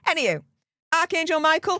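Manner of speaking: normal talking voice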